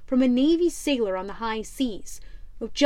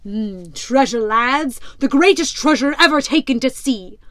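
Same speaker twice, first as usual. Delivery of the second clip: louder, gruff pirate captain scoffing; 7 dB louder than usual. Acted. Pirate captain mocks the rival ship, loud and scornful.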